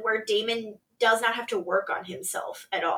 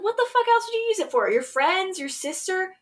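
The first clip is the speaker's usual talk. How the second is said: fast, high-pitched retort; imitated indignant rhetorical questions; mock incredulity; 8 semitones higher, faster than usual. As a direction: rattle it off in exasperated disbelief. Retold quarrel, Vaughn's scornful retort.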